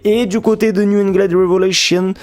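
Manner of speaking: playing the guy with an English accent on "New England Revolution"; French vlogger overdoing an English accent; show-off English accent. Shown as a demonstration. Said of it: "je fais trop le mec qui a un accent anglais".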